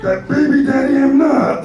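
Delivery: slow, high-pitched croaky little sage. Acted. Jedi master denying he is the father, comic Yoda voice. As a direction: stretch it out in a silly Yoda voice.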